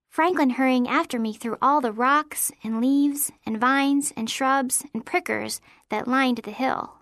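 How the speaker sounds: plain reading voice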